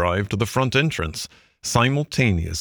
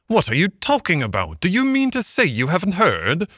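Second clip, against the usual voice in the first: high-pitched astonished questioning; greatly excited; 9 semitones higher than usual. A narrator's reading. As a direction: demand it high, in excited disbelief. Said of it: "greatly excited".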